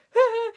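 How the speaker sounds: high, loud mock giggle; short, squeaky imitated giggle. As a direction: do the star's gleeful giggle. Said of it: "he was like".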